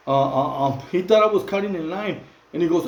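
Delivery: ordinary delivery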